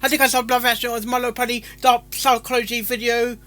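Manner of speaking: high, loud and fast announcer intro; fast upbeat channel intro; hyped intro read. Real, not acted. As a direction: rattle it off like a stream host. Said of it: "Time for speech".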